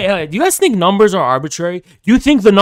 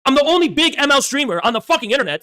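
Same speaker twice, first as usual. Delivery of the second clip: raised-pitch, heated rant at the community; angry rant; 7 semitones higher than usual. Real, not acted. Streamer ranting at his chat about being brought down, heated and high. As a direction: let the frustration pour out high and hard.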